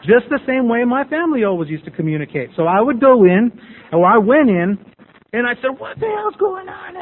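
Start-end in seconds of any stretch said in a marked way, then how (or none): 5.8-7.0 very high, drawn-out mock yell; imitated yelling. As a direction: reenact a high-pitched yell. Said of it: "started at that le-, yelling level".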